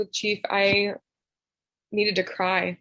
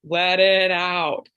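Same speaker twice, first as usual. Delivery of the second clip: drawn-out, lower coaxing "let it out"; slow soothing urging; 3 semitones lower, 5 dB louder, much slower than usual. Retold or imitated. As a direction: stretch it out gently, coaxing her to cry. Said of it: "he was just like".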